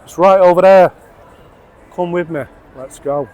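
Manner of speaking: usual speaking voice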